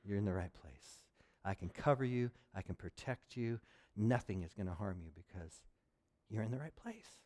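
low, soft, calming; reassuring eagle. Acted. Mother eagle comforting the scared eaglet, low soft reassurance. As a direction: soothe the little one gently. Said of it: "the eagle says".

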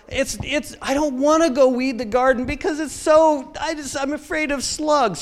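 high-pitched mock whine of a kid; hammed-up reluctant kid; excuse-making child. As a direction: plead a made-up fear in a high voice. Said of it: "Just making up stories".

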